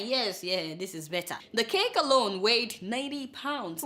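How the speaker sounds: ordinary talk, nothing marked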